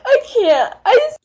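tearful, breaking voice, pushing words out through sobs; crying while gushing. Real, not acted. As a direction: sob out how much she wants them. Woman crying over cats on video.

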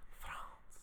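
breathy French try at the word France; whispered French accent attempt. Shown as a demonstration. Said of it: "I can't do it".

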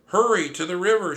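high, loud urgent shout. Acted. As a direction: yell the escape order. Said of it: "shouted my sister".

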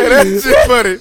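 high laughing. Real, not acted. Host still laughing at phone joke. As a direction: wheeze through laughter.

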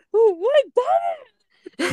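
high, mock-sobbing voice; hammed-up tearful question; retold tearful cry. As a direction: imitate crying in bewilderment. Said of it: "めっちゃ泣いてて".

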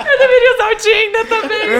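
loudly laughing, high and tipsy. Retold or imitated. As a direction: laugh it out loud, drunk. Drunk retort at bar.

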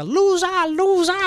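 high, loud, drawn-out imitation of a caller; long mock roll-call shout; calling out a name. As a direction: holler the name high and stretch it out. Man suddenly calls out her name.

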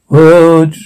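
ordinary delivery

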